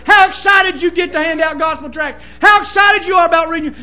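loud, driving exhortation; preaching at full force. Acted. Preacher urging the backslidden, shouting. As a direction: push the church to pray.

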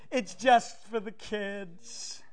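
slow, mock-innocent "just for the kids"; lightly mocking the excuse-makers; dismissive churchgoer. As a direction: brush it off like a careless parent, slowly. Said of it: "And everybody says".

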